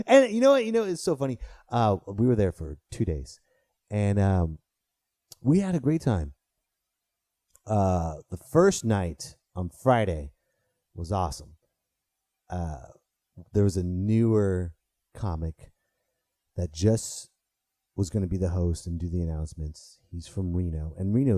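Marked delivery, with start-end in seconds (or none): none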